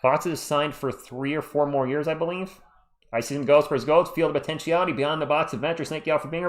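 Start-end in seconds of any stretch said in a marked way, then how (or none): none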